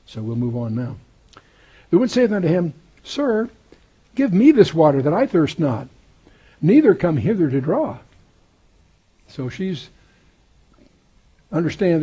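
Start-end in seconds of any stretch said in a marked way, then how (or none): 1.9-8.0 raised, louder Bible reading; scripture reading. Acted. Preacher reads the woman's request to Jesus, raised. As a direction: read the woman's plea out.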